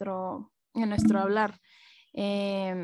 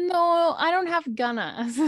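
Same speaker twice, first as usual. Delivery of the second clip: higher, slow and drawn out; a touch of teasing mimicry; playful spanglish quote; 6 semitones higher, slower than usual. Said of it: "my family has started using it".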